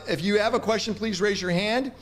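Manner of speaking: ordinary talk, nothing marked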